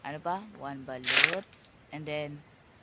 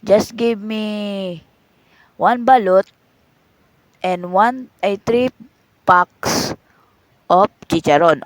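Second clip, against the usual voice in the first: slow loud order; 9 dB louder, much slower than usual. Acted. Customer ordering loudly.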